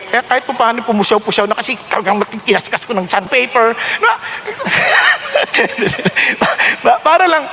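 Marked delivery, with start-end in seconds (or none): 4.2-6.8 breathless laugh. Real, not acted. Laughs at own joke.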